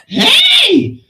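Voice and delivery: high, loud "hey" yell; explosive shout. Retold or imitated. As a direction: burst in with a high-pitched holler. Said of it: "Like that".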